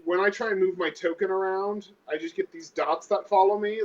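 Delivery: ordinary delivery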